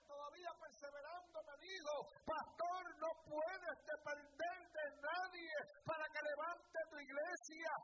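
loud, high, slow pulpit preaching; fiery preaching. Acted. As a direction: preach it out to the whole church at full force. Preaches at full force.

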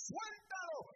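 loud, high barked order; commanding shout. Retold or imitated. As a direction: shout the command to let him go. Pastor orders brothers release man.

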